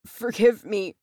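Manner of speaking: broken pleading. A narrator's reading. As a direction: plead with a breaking voice. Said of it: "his voice broken".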